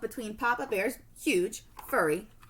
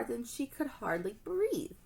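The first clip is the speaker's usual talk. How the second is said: ordinary delivery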